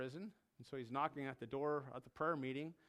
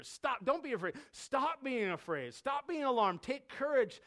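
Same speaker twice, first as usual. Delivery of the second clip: raised, insistent exhortation; urgent reassurance; 7 semitones higher, 3 dB louder than usual. Acted. Jesus to his frightened disciples, urgent reassurance. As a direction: press each command home firmly.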